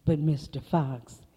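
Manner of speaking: ordinary delivery, nothing marked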